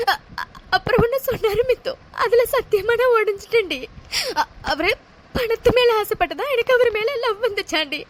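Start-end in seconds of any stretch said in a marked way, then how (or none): none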